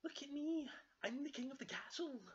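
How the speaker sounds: very high, loud cub crowing; boastful Baby Bear. Acted. Baby Bear climbs onto a rock, high triumphant boasting. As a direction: crow it like a child showing off.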